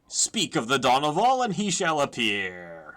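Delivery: lightly voiced grand adventurer; Donoval, theatrical. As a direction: declaim it theatrically. Reads Donoval's grand lines.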